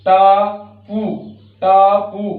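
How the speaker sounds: टापू said loud and clear; teacher's word demo. Shown as a demonstration. Teacher to pupils: टापू spoken loud for them to repeat. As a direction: announce टापू to the class. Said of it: "ऊ की मात्रा लगने से ये पू बन गया".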